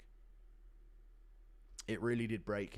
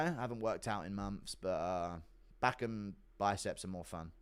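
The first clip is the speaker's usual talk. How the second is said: normal talking voice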